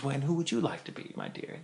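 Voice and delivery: soft tender Saint Peter voice; gentle Saint Peter. Acted. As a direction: ask kindly like a gentle saint. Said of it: "Saint Peter very gently says".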